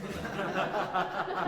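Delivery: a quick amused laugh at own joke; short real laugh. Real, not acted. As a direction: laugh at your own pun.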